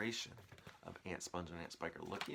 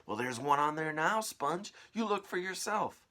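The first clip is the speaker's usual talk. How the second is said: high, loud, bossy aunt calling; shrill aunt voice; 8 semitones higher, 8 dB louder than usual. Acted. Aunt calling her sister to the tree, high and loud. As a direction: screech it out like a nasty aunt.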